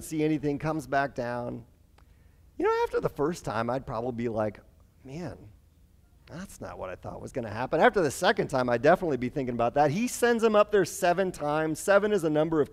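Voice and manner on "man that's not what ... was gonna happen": low, soft, deflated murmur; quiet letdown. Retold or imitated. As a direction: mumble it to yourself in disappointment. Imagined own letdown after failed sign.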